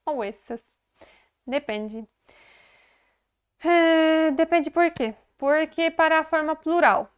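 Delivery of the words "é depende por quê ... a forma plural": raised, louder, pointed question; emphatic teacher question. Real, not acted. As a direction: pose the question to hook the class.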